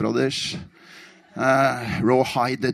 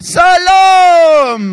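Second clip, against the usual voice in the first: very high, loud, sustained shout; full-throated roar; 12 dB louder than usual. Retold or imitated. Storyteller swept along, roaring to the hall. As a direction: bellow the greeting with everything you have. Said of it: "så brølte jeg".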